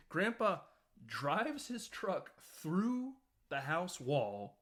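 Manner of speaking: ordinary delivery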